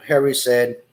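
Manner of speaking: plain reading voice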